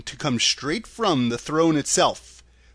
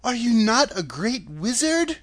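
put-on higher-pitched girl's question; lifted, disbelieving girlish voice; incredulous cry; 9 semitones higher than usual. A narrator's reading. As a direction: ask as an astonished young heroine. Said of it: "cried Dorothy".

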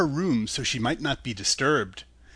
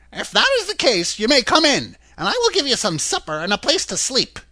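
high, startled woman's voice at the door; 8 semitones higher, 4 dB louder than usual. A narrator's reading. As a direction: play a wary housewife, pitched high. Woman agrees.

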